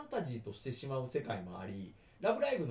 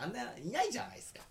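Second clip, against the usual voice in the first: normal talking voice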